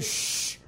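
a sharp unvoiced shh; shushing. The crowd hushing the blind man, unvoiced shh. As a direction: hush him with a shh. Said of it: "You got to be quiet".

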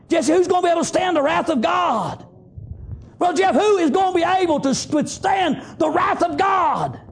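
high, shouted scripture, slowing at the end; full-force preaching. Acted. Preacher shouting Revelation to his flock, high and slowing. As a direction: cry the verse out over the congregation.

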